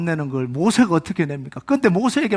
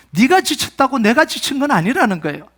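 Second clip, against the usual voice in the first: usual speaking voice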